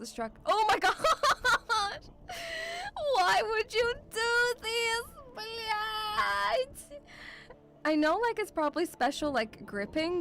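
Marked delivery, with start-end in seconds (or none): 0.5-7.0 high, drawn-out laughing distress; horrified wail. Real, not acted. Viewer horrified by climbers up a crane, wailing. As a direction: wail in helpless disbelief.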